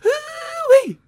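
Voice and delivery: high, loud American cowboy whoop; mock American cowboy. Keeping the joke going, hammed-up American cowboy whoop.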